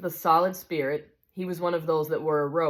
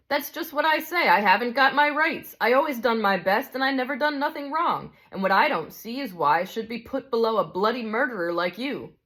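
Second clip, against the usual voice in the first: higher and louder, defensive protest; indignant big ghost; 6 semitones higher, 3 dB louder than usual. Acted. Big ghost insists on his rights to the spirit, indignant. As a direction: insist on your rights, stung.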